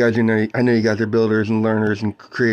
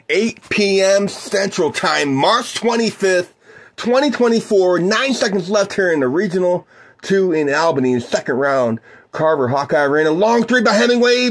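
play-by-play; 8 semitones higher than usual. Acted. Calls the game for listeners.

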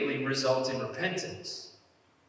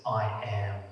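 voice of God; 5 semitones lower than usual. Retold or imitated. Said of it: "moments where he just says".

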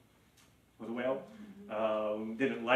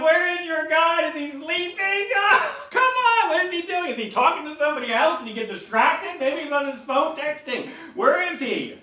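high, loud taunting jeer; mocking Elijah; 7 dB louder than usual. Elijah mocking the prophets of Baal, high taunting laugh. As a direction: scoff at the silent god, laughing. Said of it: "Elijah's laughing at them".

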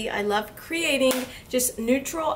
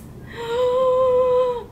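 delighted gasp; 11 semitones higher, 3 dB louder than usual. Real, not acted. Gasps at palette.

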